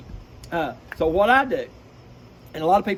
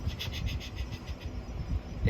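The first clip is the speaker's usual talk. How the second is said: long, slow unvoiced shushes; slow hunter's shh; shushing; 9 dB quieter, slower than usual. Shown as a demonstration. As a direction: hush everyone down. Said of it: "and I go".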